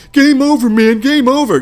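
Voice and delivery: loud, high, desperate yell; panicked shout. Retold or imitated. Quoting the Aliens marine, a panicked high shout. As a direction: shout it like everything is lost.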